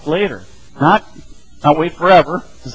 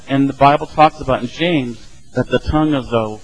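ordinary talk, nothing marked

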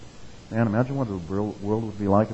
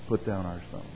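softer plea; 3 semitones lower, 11 dB quieter than usual. Real, not acted. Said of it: "repeated softer".

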